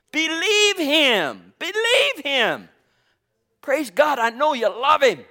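high, rising, calling out to the congregation; full-throated preaching, pressing for amens; preaching at full force. Acted. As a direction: drive it home like a revival preacher. Preaching builds to a climax.

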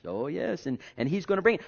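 ordinary delivery

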